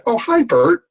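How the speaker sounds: father greeting Burt Lancaster in a cartoon voice; Elmer Fudd Daffy Duck voice. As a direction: do a silly cartoon-duck hello. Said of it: "best Elmer Fudd Daffy Duck imitation".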